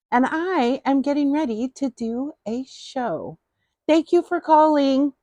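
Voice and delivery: high, playful mock phone-answering voice; pretend phone call. Host fakes taking a call about the show, playfully high.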